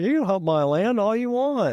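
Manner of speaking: high-pitched put-on farmer voice. Farmer granting the hunter his land, high put-on voice. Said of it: "met this farmer, and he goes".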